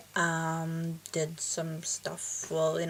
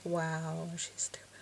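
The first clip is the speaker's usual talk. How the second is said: hushed, breathy jab behind her back; mock whisper of a classmate calling her stupid; whispered mockery; 3 semitones lower, 7 dB quieter, slower than usual. Imagined classmates mocking her.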